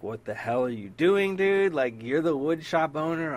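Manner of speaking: blowing up at him in disbelief; exasperated retold yell. Retelling yelling at the wood shop guy, exasperated. Retold angry rant at woodworker.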